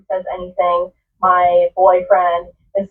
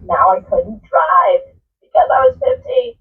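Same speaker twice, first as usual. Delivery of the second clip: ordinary talk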